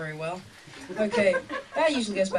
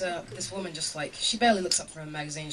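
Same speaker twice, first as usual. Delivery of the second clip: usual speaking voice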